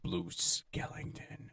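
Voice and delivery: slow, drawn-out ghostly whisper; spooky whisper. Retold or imitated. Prank caller naming himself to the spooked kid, slow creepy whisper. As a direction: whisper the name like a ghost story.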